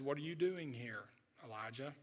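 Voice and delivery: God asking Elijah softly, barely above a breath; gentle quiet voice. A narrator's reading. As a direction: ask it as gently as a breeze. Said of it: "God's voice came in a gentle breeze".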